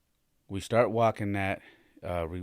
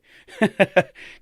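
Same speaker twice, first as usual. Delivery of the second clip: a loud burst of laughter; high loud amused laugh; 13 semitones higher, 13 dB louder than usual. Real, not acted. Laughs mid story about sons.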